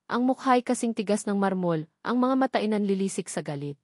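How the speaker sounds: ordinary delivery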